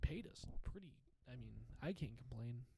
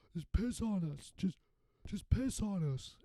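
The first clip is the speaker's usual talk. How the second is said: loud, slow, hammed-up drunken holler; drunk friend shouting; 6 semitones higher, 8 dB louder than usual. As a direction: bellow it like a wasted buddy on the floor. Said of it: "and Nick just goes".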